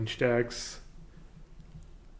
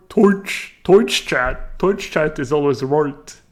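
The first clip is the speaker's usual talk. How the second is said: loud, overdone Irish, "Twitch" as toitch; Irish accent; 2 semitones higher, 8 dB louder, slower than usual. Shown as a demonstration. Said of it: "They say oi instead of I?".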